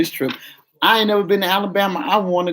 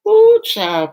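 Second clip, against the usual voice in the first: sassy exclamation; 6 dB louder than usual. Real, not acted. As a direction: squeal it like juicy gossip. Exclaiming before a confession.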